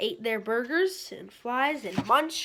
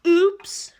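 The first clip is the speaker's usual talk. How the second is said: high, loud startled oops; cried oops; 7 semitones higher, 12 dB louder than usual. Acted. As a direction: blurt it out in surprise. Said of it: "cried Froggy".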